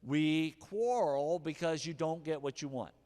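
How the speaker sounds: slow emphatic statement. Shown as a demonstration. Slow emphatic restatement of James.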